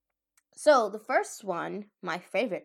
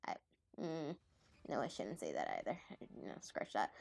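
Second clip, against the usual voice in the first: low, soft aside to self; muttering to self; 8 semitones lower, 14 dB quieter than usual. Real, not acted. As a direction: mutter it under your breath.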